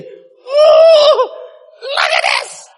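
very high, loud ecstatic cries; acted awestruck delight. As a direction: squeal with childlike wonder, stretching the words. Acts out Moses awestruck by God.

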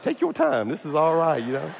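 lightly put-on gas-happy ease; blissfully relaxed. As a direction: act pleasantly dazed on laughing gas. Acts out being relaxed on nitrous.

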